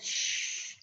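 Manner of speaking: long, soft shushing sound; quiet hush; hushing shh. Shown as a demonstration. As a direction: shush the class with a drawn-out "shh".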